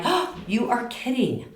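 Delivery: high gasped disbelief; shocked sympathy. Retold or imitated. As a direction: react aghast to a friend's bad news. Sympathetic reaction to friend.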